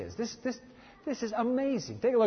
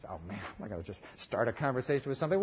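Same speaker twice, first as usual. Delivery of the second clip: nervous listener; 8 semitones lower, 7 dB quieter, faster than usual. Pretend.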